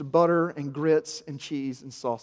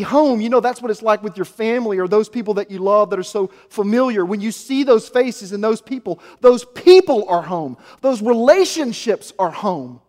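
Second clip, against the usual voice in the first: loud, higher preaching build; impassioned preaching; 8 semitones higher, 11 dB louder than usual. Acted. Pastor to congregation, preaching loudly about paradise. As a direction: preach it out to the whole room.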